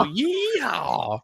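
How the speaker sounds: high, drawn-out country yeehaw; mock hillbilly cheer.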